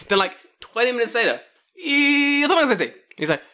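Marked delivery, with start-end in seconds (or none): 1.8-2.9 high, loud call to a colleague. Retold or imitated. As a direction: hail them loudly from across the room. Calling colleague out, retold prank.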